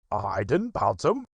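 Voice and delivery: lightly voiced tiger; Tigger voice. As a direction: Tigger protesting. Tigger denies.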